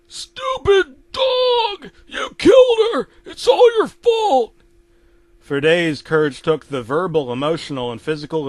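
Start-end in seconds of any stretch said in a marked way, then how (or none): 0.1-4.5 loud, very high enraged scream; Eustace raging. Acted. Eustace blaming the dog for his wife's death, raging. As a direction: scream slowly in high-pitched blame.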